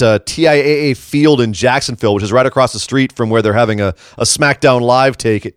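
normal talking voice

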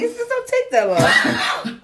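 high, loud and slow through laughs; laughing shout. Real, not acted. Friend laughing about smoke breaks, loud and high. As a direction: belt it out laughing.

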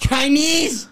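very high mimicked Scouse; Scouse accent. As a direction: parrot a Liverpool woman's Scouse at a high pitch. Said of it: "one of them accents".

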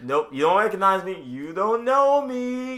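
playful mock-smug nope; teasing denial. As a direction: tease them that they don't know you. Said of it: "I was like".

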